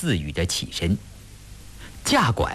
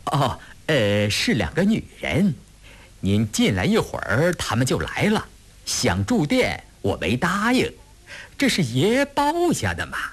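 higher-pitched, deferential innkeeper voice; obliging innkeeper; 6 semitones higher than usual. A narrator's reading. Innkeeper explains to the guest who booked the place, deferential. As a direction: explain yourself eagerly to a valued guest.

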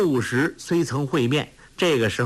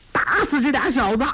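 put-on much higher furious child's yell; angry yell; 12 semitones higher than usual. A narrator's reading. Dushi orders his gang to attack, furious yell. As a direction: scream the order to beat them. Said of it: "都史怒叫".

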